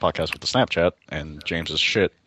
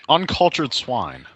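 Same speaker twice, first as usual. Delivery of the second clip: drawn-out insult; 5 semitones higher, much slower than usual. Acted. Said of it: "that's my line".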